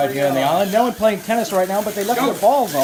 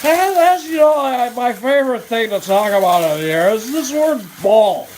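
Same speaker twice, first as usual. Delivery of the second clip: Harry Caray yelling; 4 semitones higher than usual. Acted. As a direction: bellow after Steve as the ballpark announcer. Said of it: "you're Harry Caray".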